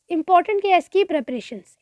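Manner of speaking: ordinary talk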